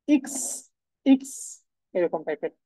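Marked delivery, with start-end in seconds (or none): 0.1-1.3 ইক্স said as German X, not এক্স; German letter name. Shown as a demonstration. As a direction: say ইক্স short and crisp. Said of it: "এক্স এর যে উচ্চারণ সেটা হচ্ছে কি?". (German X-এর নাম ইক্স)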